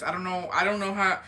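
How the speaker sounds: ordinary delivery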